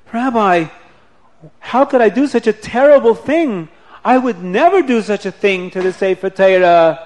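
put-on a shaken boy refusing in horror; horrified plea.

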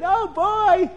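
high, loud, laughing with glee; giddy king who can't wait; thrilled royal chuckling with delight; excited king. Acted. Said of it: "the king started getting so excited".

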